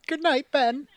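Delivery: high, lightly voiced old lady; wistful old aunt. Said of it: "Every night with a glass of whiskey".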